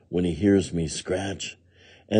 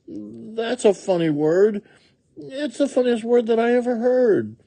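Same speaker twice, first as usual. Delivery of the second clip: very high woodchuck voice; puzzled woodchuck. A narrator's reading. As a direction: marvel at it in a high critter voice. Woodchuck figure in story.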